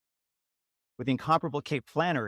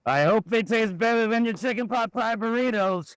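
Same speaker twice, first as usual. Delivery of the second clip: high, loud, sneering put-on voice; snooty rival; 10 semitones higher, 5 dB louder than usual. Rival mocks Lunch Lady's cooking, high sneering voice.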